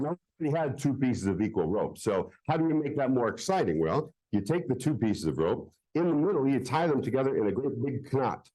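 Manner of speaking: ordinary delivery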